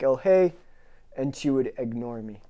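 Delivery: ordinary talk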